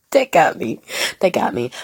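ordinary talk, nothing marked

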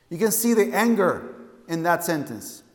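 usual speaking voice

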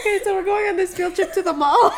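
high, fast, giggling words; barely holding back a laugh; laughing through it. Real, not acted. Telling co-host about the mall trip, laughing helplessly. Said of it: "we're about to be cracking up".

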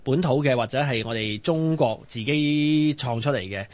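ordinary talk, nothing marked

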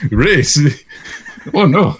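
ordinary talk

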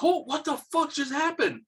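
high, stunned exclamation; retold shock. Retelling the bow mishap, high-pitched disbelief. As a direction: act out being baffled by what just happened.

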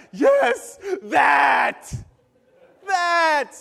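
high, shouted mock jubilation; ecstatic parents. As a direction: shout each word in triumph. Parents thrilled at obedient child.

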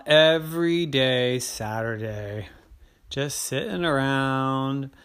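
slow, drawn-out storyteller; story narration. A narrator's reading. Narrator listing Terry's lazy Saturday, slow and drawn out. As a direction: savour each lazy activity.

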